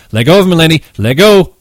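loud frantic command; frantic shout. Acted. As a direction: bark it over the noise. George ordering Lenny.

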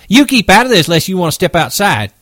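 high, loud, fast threat; Curly squaring up to Carlson, fast and high; threatening. Acted. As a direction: snap the challenge out quickly. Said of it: "Curly whirled on Carlson".

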